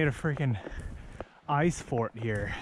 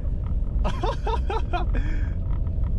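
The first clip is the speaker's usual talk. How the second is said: delighted laugh, much higher; high excited laugh. Real, not acted. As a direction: laugh out in disbelief. After plowing through.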